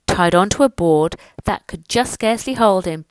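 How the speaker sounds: plain reading voice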